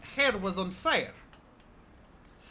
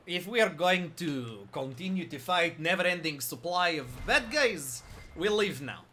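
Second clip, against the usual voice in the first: deliberately bad Russian accent, lower at the end; mock Russian accent; 3 semitones lower than usual. Shown as a demonstration. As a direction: gamer playing a Russian character, hammed up. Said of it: "it's not gonna be a good Russian".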